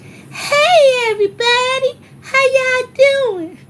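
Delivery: put-on high, loud valley-girl greeting, slow on the last words; imitating Lucretia's voice. Teacher walks into class greeting everyone, high mock valley voice. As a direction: chirp it out like a bubbly teacher entering. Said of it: "That's how she used to talk".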